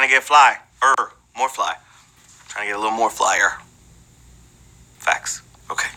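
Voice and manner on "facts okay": whispered. Acted.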